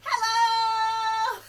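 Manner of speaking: big, high, drawn-out shout of hello; loud. Shown as a demonstration. Storytime host leads children in the loudest hello, shouting. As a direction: belt it out as loud as you can. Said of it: "Let's say hello as loud as we can".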